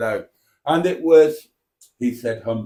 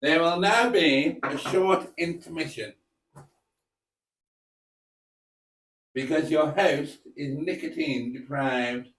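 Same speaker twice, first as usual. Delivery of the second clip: softer, put-on northern English voice; generic northern accent; 2 semitones higher, 4 dB quieter than usual. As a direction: play a northern English announcer, lightly. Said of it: "it's quite easy to slip into a generic northern accent".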